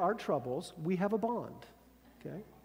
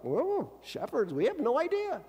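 mock bafflement; 7 semitones higher than usual. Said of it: "they're like".

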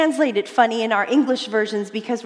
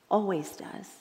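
ordinary delivery

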